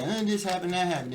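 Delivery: mocking a boyfriend who always complains; mock whiny boyfriend. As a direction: whine about a bad day to mock him. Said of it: "your man always coming home being emotional".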